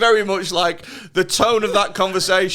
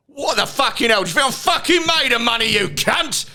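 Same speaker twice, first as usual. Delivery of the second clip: higher-pitched Cockney rant; angry Cockney; 6 semitones higher than usual. Retold or imitated. Playing the dad raging over bills, angry Cockney. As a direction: explode into a mock Cockney tirade. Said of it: "he turns Cockney".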